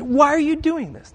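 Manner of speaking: high, harsh, snorted question; scoffing rebuke. Retold or imitated. Critics rebuking the woman, a high scoffing question. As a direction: sneer it down at her. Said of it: "what they do is".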